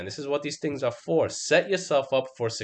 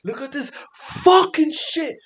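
high, loud mock rant; high-pitched mock rage at the chart; retold meltdown; 13 semitones higher, 5 dB louder than usual. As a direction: replay losing it over the trade. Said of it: "I was like".

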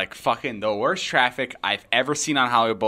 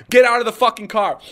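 loud, raised imitation of cops; shouted police order; 10 semitones higher, 7 dB louder than usual. Cops ordering people out at gunpoint, shouted. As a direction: bark it like an armed officer.

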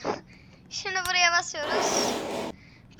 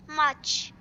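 ordinary talk, nothing marked

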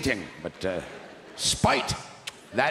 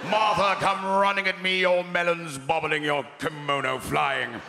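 Richard Burton; 2 semitones higher than usual. Acted. Drunk husband calling his wife, hammy English accent. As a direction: declaim like a hammy English stage actor. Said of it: "Richard Burton coming home".

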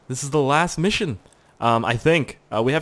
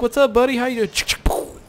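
mock greeting Hitler; 11 semitones higher than usual. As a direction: greet him like an old pal.

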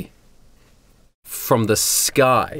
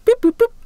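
high, loud electronic boops; imitated dialing beeps; 5 dB louder, faster than usual. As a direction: boop like a keypad, high-pitched. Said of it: "she starts doing something".

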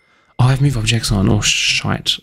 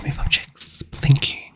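whisper; 6 dB quieter than usual. Real, not acted. Muttering to herself at keyboard.

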